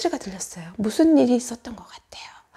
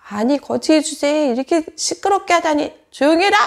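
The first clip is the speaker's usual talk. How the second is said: indignant telling-off, ending in a loud order; acted rebuke, loud on the last command; scolding; 5 dB louder than usual. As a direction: scold a noisy beggar. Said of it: "바디메오에게 막 꾸짖었어요".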